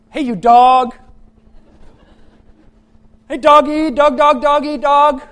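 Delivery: high, very loud taunting shout; tough little kid. Retold or imitated. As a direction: bark bravely at the dog from dad's arms. Kid acting tough in story.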